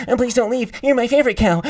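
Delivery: high, loud pleading; overacted live take. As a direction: plead it hard like on set. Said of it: "you're watching a movie".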